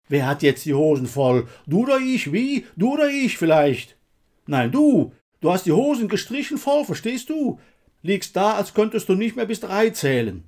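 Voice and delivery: loud, high-pitched jeering; heated, accusing challenge; agitated taunting. A narrator's reading. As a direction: throw it in his face, worked up. Taunting the fallen comrade.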